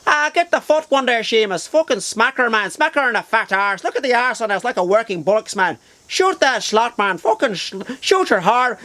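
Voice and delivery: high-pitched, lilting Dublin thug; excitable caricature of a Dublin accent. Retold or imitated. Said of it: "they had the Dublin accent".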